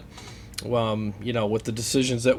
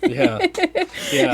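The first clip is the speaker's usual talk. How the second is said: laughing; 3 dB louder than usual. Real, not acted.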